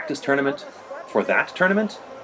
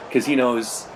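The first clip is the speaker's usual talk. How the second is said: ordinary delivery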